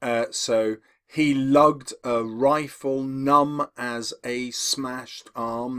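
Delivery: slow, even da-DUM beat on "so he lugged a rifle numb as a smashed arm"; measured poetry reading, stresses on "lugged", "ri", "numb", "smashed", "arm"; slow iambic reading. Shown as a demonstration. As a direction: read it slow and mark each iambic beat. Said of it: "That's mostly iambic".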